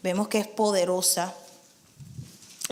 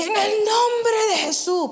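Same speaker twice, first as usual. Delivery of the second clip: commanding rebuke from within; 10 semitones higher than usual. Retold or imitated. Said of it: "me salió de adentro".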